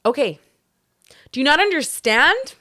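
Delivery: loud, high exasperated question; mock outburst, seconds from yelling; near-yelling at customer. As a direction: snap it, rising sharply on understand. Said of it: "I've never yelled at anyone".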